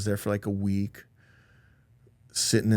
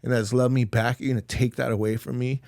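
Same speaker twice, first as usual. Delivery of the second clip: normal talking voice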